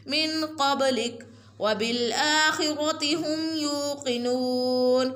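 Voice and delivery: Quran recitation. Shown as a demonstration. Teacher recites for viewers in sung tajweed.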